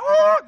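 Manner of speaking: piercingly high, loud "ooh"; sudden falsetto whoop; preacher's whoop. Real, not acted. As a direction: break off into a joyful holler. Preacher whoops mid-verse.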